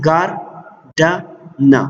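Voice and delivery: ګارډنه very slowly as gar, da, na with pauses; syllable by syllable. Shown as a demonstration. Teacher counting syllables for learners, ګارډنه said syllable by syllable. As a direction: break ګارډنه into three spaced beats. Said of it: "three vowel sounds".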